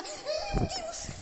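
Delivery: very high frightened squeal; panicked cry. Real, not acted. Hears something, squeals in fear.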